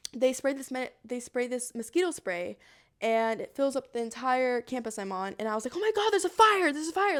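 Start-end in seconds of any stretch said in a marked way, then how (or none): 5.8-7.2 high, loud re-enacted fire alarm cry; paranoid alarm. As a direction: blurt it in exaggerated panic, then ease slightly. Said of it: "I'm getting so paranoid".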